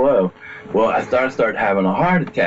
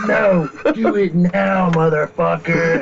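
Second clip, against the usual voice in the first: higher, slow, laughing mock-command; devil voice; 9 semitones higher, slower than usual. Devil voice demanding he pick up trash now, laughing. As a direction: boss him around as the devil, through a laugh. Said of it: "And it said".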